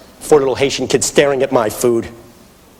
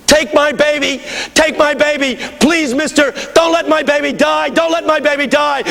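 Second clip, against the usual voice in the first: very high, frantic imitated scream; screaming plea. Mother runs after the departing stranger, screaming plea. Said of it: "screaming at the top of her lungs".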